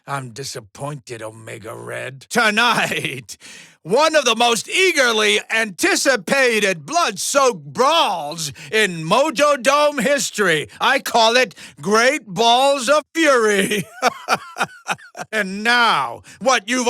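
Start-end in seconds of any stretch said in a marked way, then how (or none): 0.0-2.3 low, soft reproach; hushed, low displeasure; disappointed. Acted. Host scolds losing fighter. 13.9-14.7 very high, quick cackle; high-pitched gleeful laugh; showman's laugh. Acted. Laughs at own fight title.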